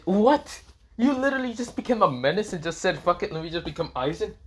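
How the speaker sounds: high-pitched incredulous outburst; acted disbelief. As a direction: squeak it out, then ease down slightly. Acted disbelief at friend's new identity.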